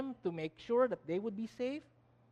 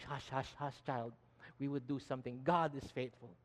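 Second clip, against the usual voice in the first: low, soft hushing; soothing mother; 5 semitones lower, 5 dB quieter than usual. Acted. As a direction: hush an anxious child, lower and softer. Voicing a mother hushing her child.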